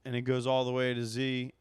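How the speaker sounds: usual speaking voice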